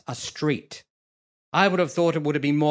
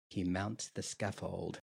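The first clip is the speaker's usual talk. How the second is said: hushed aside; 8 semitones lower, 15 dB quieter than usual. Acted. Stage direction spoken aside.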